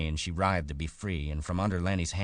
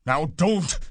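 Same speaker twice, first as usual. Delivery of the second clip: high, loud angry command; 13 semitones higher, 9 dB louder than usual. A narrator's reading. Big man turning angry at her noise, loud. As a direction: burst out crossly, pitched way up. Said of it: "Then Lenny grew angry".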